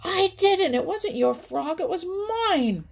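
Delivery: high squabbling, loudest on the denials; acted storybook bird row; angry quarrel. A narrator's reading. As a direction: bicker furiously over who owns it. Heron and hawk quarrel over frog.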